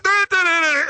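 high, loud nonsense babble; mock TV chatter. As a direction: babble like distant TV voices. Imitating TV noise heard.

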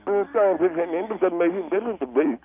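usual speaking voice

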